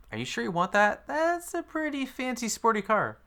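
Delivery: high-pitched mock concern; doubtful husband. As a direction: imitate a hesitant husband in a high voice. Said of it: "And then her husband's like".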